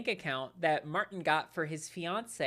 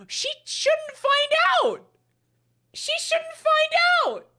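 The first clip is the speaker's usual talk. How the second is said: mocking voice; 12 dB louder, much slower than usual. Mocking the poster's words.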